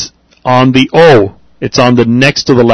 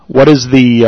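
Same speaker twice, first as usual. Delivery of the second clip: ordinary talk, nothing marked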